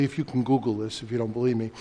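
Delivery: usual speaking voice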